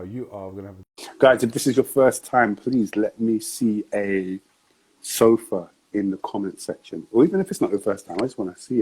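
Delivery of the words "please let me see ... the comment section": usual speaking voice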